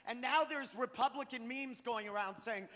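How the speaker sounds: ordinary talk, nothing marked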